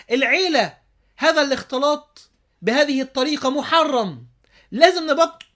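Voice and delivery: loud, high, emphatic condemnation; stern admonishing. Acted. Objector scolding a family over mixing, stern and loud. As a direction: declaim the ruling forcefully, word by word.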